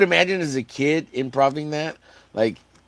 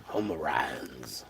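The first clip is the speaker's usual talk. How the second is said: whispered jab; 10 semitones lower, 10 dB quieter, much slower than usual. Real, not acted. Repeats insult under breath.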